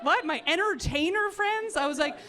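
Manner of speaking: high, flustered, half-laughing deflection; caught-off-guard comic dodge. Retold or imitated. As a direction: stall nervously while laughing it off. Flustered answer to mom.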